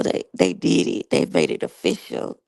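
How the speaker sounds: ordinary delivery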